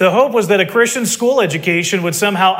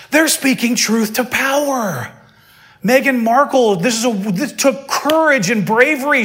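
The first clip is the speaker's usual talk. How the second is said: put-on higher, slow earnest young idealist; young activist voice; 5 semitones higher, slower than usual. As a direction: gush about courage and bravery. Mimicking young people's response.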